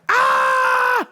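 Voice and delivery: high drawn-out outraged scream; mock scream. Customer told to go away, mock screaming. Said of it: "Just starts screaming".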